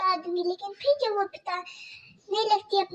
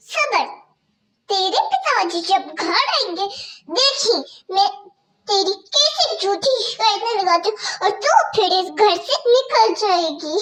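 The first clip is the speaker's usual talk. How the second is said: harsh stepmother scolding; 6 dB louder than usual. Acted. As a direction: bark orders at the girl like a cruel stepmother. Stepmother threatens.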